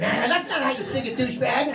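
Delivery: mock kid scolding. Kids scolding dad.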